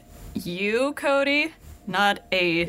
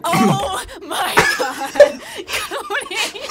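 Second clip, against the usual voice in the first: high, loud laughing outcry; laughing exasperation; 11 semitones higher, 6 dB louder, much slower than usual. Real, not acted. Co-host losing it at Cody's antics, real laughing exasperation. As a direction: crack up while crying out in disbelief.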